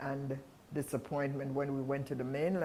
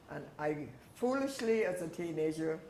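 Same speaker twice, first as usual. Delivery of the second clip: ordinary talk, nothing marked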